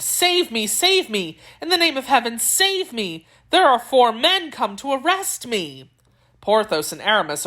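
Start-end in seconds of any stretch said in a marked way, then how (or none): 0.0-5.9 high-pitched, urgent plea; acted panic, raised in pitch rather than volume; frightened exclamation. A narrator's reading. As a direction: beg desperately for rescue. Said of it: "he exclaimed".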